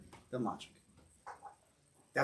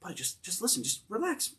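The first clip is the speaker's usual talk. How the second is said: soft calming aside; 3 semitones higher, 9 dB quieter than usual. Retold or imitated. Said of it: "he whispers to him".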